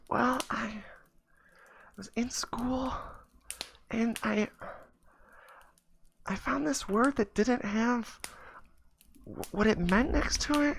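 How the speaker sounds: put-on very high, soft, halting child's voice, half-whispered; little kid voice. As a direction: ramble nervously like a tiny boy in court. Five-year-old tells judge his story.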